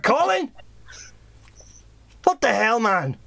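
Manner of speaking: high, incredulous mock shout; acted exasperated boss. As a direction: call out the name high, then drop in exasperation. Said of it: "he just shouts about".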